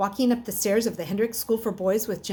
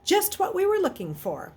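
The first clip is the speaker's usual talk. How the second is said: hearty; 11 semitones higher than usual. A narrator's reading. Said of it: "Mister Talbot said heartily".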